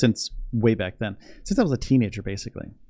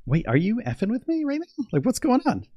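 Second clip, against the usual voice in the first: much higher, imitating his stunned self; mock disbelief; 12 semitones higher than usual. Acting out asking her if she's joking, excited. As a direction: pitch it way up in disbelief. Retold reply to girlfriend.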